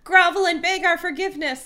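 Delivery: high, pompous command; demanding alien. A narrator's reading. Helmicrons demanding the humans grovel, high pompous voice. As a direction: order them to grovel. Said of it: "the Helmicrons demanded".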